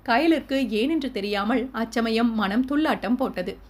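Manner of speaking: ordinary delivery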